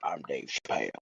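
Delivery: very low, very soft smoker's voice; quiet, mellowed deep impersonation; Dave Chappelle. Acted. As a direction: drop it way down, as if the lungs are crushed. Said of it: "that's how he talks now".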